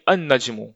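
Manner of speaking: अन-नज्मु with doubled n, no L; silent L. Shown as a demonstration. Teacher reads from the book, अन-नज्मु with long n. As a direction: turn the L into a held n in अन-नज्मु. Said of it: "अल नहीं पढ़ेंगे".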